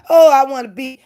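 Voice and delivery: mock whiny high voice; sneering impression of a wannabe. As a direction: mimic her fake eagerness. Mocking rival's fake talk.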